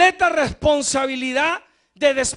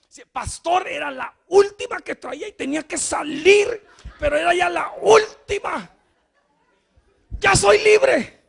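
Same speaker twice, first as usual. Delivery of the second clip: rising, louder flustered excuse with a laugh; hammed-up caught man blurting excuses; caught brother's excuse; 5 semitones higher, 7 dB louder than usual. (hermano sorprendido que se excusa, cada vez más fuerte)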